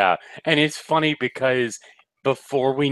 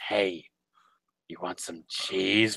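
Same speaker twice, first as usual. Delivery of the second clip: whispered like a back-alley dealer; shady whisper; 5 semitones lower, 9 dB quieter, slower than usual. Retold or imitated. Offering cheese like a dealer, shady whisper. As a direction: lean in and sell it on the sly.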